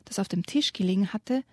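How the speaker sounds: ordinary delivery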